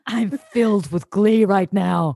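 slow, flat, joyless glee; deadpan monotone. Pretend. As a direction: drag out mock glee, deadpan. Mocking Bart's monotone.

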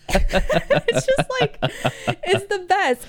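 helpless laughing through the words; real laughter. Real, not acted. Fan delighted by a favorite episode, laughing hard. Cracks up at own song quote.

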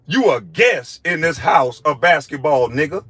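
ordinary delivery

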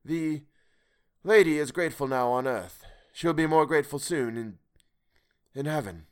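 the strange voice. Acted. Stranger's voice in the dark.

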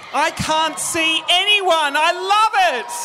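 high, loud, elated crowd greeting; excited stage shout. Real, not acted. Comedian greeting Brisbane crowd he can't see, shouting excitedly.

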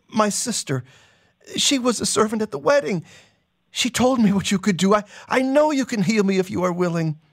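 a mild sobbing beg; pleading through tears. Acted. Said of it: "He's sobbing now".

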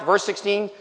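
ordinary talk

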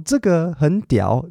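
slow, careful beginner Mandarin; a hint of a foreign learner; beginner foreigner speaking Mandarin. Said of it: "他才刚学一个月".

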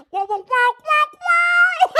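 very high, repeated wows; high wow squeal. Retold or imitated. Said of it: "it'll be like".

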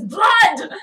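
loud, high mock battle cry; hammed-up outraged shout; riled-up cry. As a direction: yell it out like a riled-up rabble-rouser. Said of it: "really getting their dander up here".

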